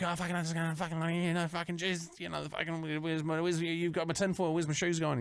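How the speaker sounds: a flustered meth-head babbling to cops; scattered chat. Retold or imitated. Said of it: "that sort of just for a scattered chat".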